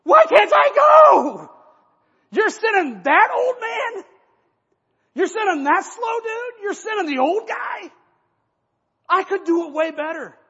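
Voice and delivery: high, loud, slow, pleading shout; a brash young runner protesting loudly; indignant squeal of a young man passed over; whiny Ahimaaz shout. Acted. Ahimaaz begging to be sent.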